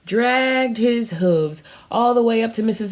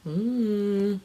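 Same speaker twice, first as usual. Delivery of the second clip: plain reading voice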